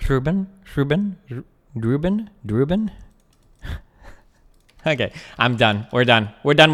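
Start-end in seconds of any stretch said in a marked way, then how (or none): 0.1-2.9 repeated high, rising Ruben, trying the trill; rolled R. Shown as a demonstration. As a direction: practise rolling the R in Ruben, questioningly. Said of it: "do you roll his name?".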